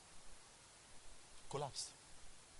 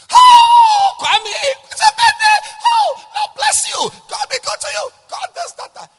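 much higher, louder, gushing mother; overjoyed mother; 19 dB louder than usual. Acted. Mother gushing over son's birthday on phone. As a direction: squeal it like a delighted mom.

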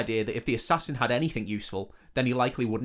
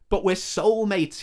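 higher, slow whiny plea; whining; 8 semitones higher, 4 dB louder, slower than usual. Acted. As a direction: whine it like a spurned lover. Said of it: "he had whined".

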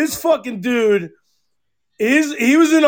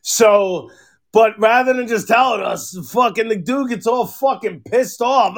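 ordinary delivery

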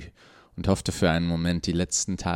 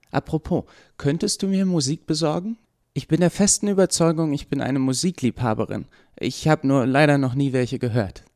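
put-on higher young voice, lively and teasing; amused Eva; 8 semitones higher, 3 dB louder than usual. A narrator's reading. Eva teases the doctor and asks for music, lively higher voice. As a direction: play Eva amused and eager, building with excitement.

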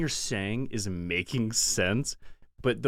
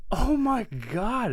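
shocked; 10 semitones higher, 3 dB louder, slower than usual. Retold or imitated. Retold shock at the door.

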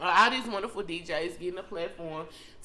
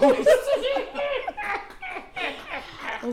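squealing laugh; high laughter. Real, not acted. Laughing hard.

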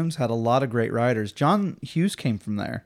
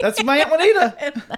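excited recognition; 12 semitones higher, 3 dB louder than usual. Real, not acted. Laughing recognition of aunt.

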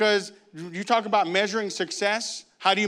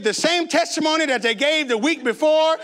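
higher, insistent repetition; comic exasperation; 6 semitones higher, 3 dB louder than usual. Retold or imitated. Preacher recalls the same weekly testimony, higher comic exasperation. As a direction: hammer the repeats in a raised pitch.

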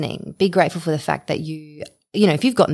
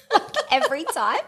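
laughing tease. Real, not acted. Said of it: "teasing, laughing".

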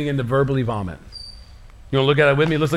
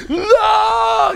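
frustrated yell; 4 dB louder than usual. Acted. Frustrated like a three-year-old.

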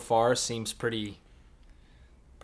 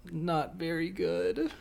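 slow, high, soft and deflated; mopey reply; 7 semitones higher, 3 dB quieter, much slower than usual. Retold or imitated. Answering a friend's hello, slow and mopey. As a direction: let the gloom seep out. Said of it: "I just go".

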